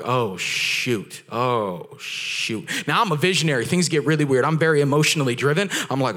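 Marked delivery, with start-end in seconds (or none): 0.0-2.7 "Oh, shoot" dropping to a breathy whisper; sudden realization. Retold or imitated. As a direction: caught off guard, trailing into a whisper.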